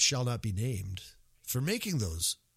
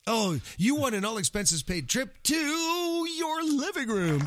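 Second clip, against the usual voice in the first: mock prize announcer; 12 semitones higher than usual.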